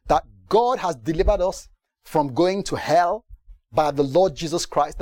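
normal talking voice

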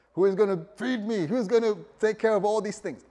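hammed-up worry; preacher imagining himself panicking; mock panic. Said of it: "I'd just be thinking that".

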